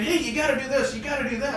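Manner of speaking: high-pitched pestering mock child; bossy kid imitation. Therapist likens client's brother to a ten-year-old, bossy kid voice.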